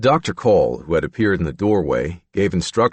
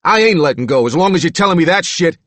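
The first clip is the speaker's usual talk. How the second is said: loud, high tough-guy retort; tough, angry; 9 semitones higher, 6 dB louder than usual. Acted. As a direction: stand your ground loudly. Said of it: "Roop said".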